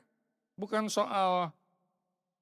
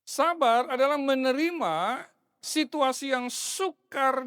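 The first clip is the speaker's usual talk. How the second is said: higher and louder, proclaimed; full-force preaching; 5 semitones higher, 3 dB louder than usual. Acted. Pastor proclaims the definition of patience, louder and higher. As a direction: declare it to the whole church.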